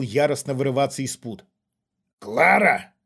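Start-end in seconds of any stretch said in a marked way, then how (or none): 2.2-2.9 louder, drawn-out repeat of the name; questioning echo. A narrator's reading. Intruder turns to the daughter, questioning echo. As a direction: echo the name with sudden interest. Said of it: "переспросил Кшиштоф".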